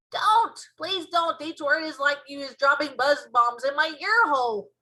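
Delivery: loud, high-pitched begging; pained pleading. Acted. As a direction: beg loudly for the noise to stop. BFG begs her to stop.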